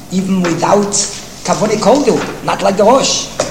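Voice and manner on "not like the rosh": ordinary delivery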